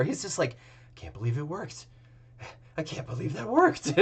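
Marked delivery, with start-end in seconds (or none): 0.9-1.8 softer, stunned under the breath; dazed murmur. Acted. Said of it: "his eyes are elsewhere". 2.8-3.9 higher, dawning disbelief; stunned disbelief. Acted. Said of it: "his eyes are elsewhere".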